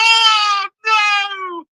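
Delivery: real dismay; anguished cry. Real, not acted. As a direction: cry out as it hits the post. Shot hits the post.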